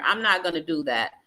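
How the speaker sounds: normal talking voice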